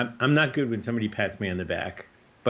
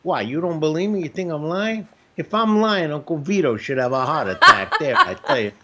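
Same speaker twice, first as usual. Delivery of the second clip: higher, louder Italian relative, half laughing; hot-headed Italian relative blaming Uncle Vito; loud, high Italian relative swearing it's the truth; 5 semitones higher, 5 dB louder than usual. Retold or imitated. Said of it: "My Italian family, they put it on somebody else".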